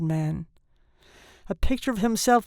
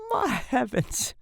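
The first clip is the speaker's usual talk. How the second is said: slow, high, appalled exclamation; horrified murmur; 4 semitones higher, slower than usual. A narrator's reading. Father sinks into a chair, murmuring in horror. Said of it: "he murmured in an ecstasy of horror".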